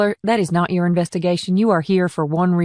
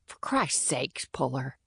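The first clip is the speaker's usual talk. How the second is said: low, much softer hissed rebuke; 4 semitones lower, 12 dB quieter, slower than usual. A narrator's reading. Cole hisses at Puller not to invite them, much softer. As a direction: hiss it under your breath. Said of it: "hissed Cole".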